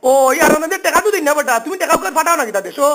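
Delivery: ordinary talk, nothing marked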